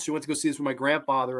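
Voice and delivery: ordinary talk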